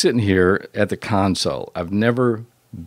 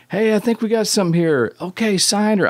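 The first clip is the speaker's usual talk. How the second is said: much higher, quick-deal enthusiasm, retold; imitated excited phone pitch; 12 semitones higher than usual. Producer pitching a tape to the label by phone, imitated excitement. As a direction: toss it off high, imitating an instant yes.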